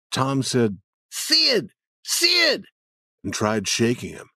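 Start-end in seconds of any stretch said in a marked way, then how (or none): 1.1-2.7 put-on boy calling out. Tom calls to wake Sid.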